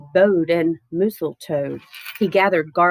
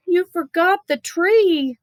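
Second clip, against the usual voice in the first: put-on higher child voice, alarmed; child's dismayed cry; 10 semitones higher than usual. A narrator's reading. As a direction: squeak it out like a shocked kid.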